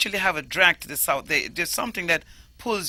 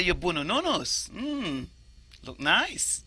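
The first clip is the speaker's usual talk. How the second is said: bonononos said softly with a Patois lilt; admiringly, you're bonononos in Jamaican; Jamaican Patois compliment; 4 dB quieter, slower than usual. Shown as a demonstration. Teaching a Patois compliment, soft Jamaican accent. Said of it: "But if I say".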